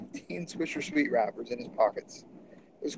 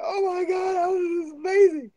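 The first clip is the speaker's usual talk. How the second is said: excited; 5 dB louder than usual. Retold or imitated. Cheering the shopkeeper, clapping.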